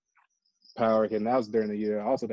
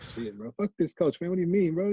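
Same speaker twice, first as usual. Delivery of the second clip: higher-pitched imitation of a griping player; pitched-up retold complaint; mock player protest; 8 semitones higher than usual. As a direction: protest like a fed-up player, half amused. Voicing a fed-up player's protest.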